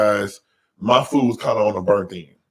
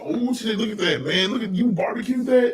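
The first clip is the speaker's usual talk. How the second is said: much higher, excited mock exclamation; imitated amazement; 9 semitones higher, 5 dB quieter than usual. Quoting Adrian tasting the ribs, excited mock shout. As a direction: marvel like he can't believe it. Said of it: "Adrian said".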